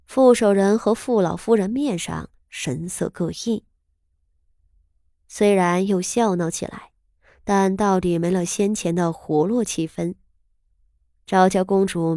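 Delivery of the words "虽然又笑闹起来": usual speaking voice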